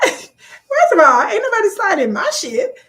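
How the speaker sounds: high, loud, laughing outburst; incredulous squawk with a laugh; mock indignation. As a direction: protest in comic disbelief, stretching the opening. Acts out her indignant reaction.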